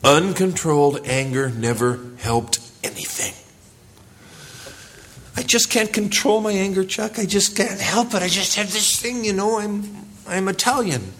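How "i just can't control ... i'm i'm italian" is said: higher, whiny voice of someone excusing their temper; mock angry excuser. Pastor mimics an angry churchgoer making excuses to Chuck, whiny and higher. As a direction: play the guy who blames his temper on his roots.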